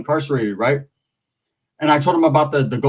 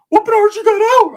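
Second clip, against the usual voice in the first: high squeaky voice for the cousin; squeaky high-voiced cousin calling; 6 dB louder than usual. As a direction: pitch way up as the cousin.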